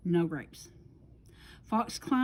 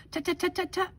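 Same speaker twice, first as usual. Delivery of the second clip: higher, mock-scolding tut-tuts; tutting; 8 semitones higher, faster than usual. Fox interrupting bear with scolding tuts. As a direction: cluck disapprovingly.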